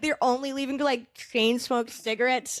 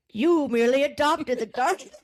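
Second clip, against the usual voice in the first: Bane impression; 3 semitones higher, 3 dB louder than usual. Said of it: "the Batman thing".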